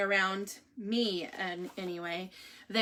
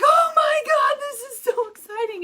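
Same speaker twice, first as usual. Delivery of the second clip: nurse squealing, much higher and louder; freaking out; 8 dB louder than usual. Retold or imitated. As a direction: mimic the nurse gushing with excitement. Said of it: "She, like, freaked out".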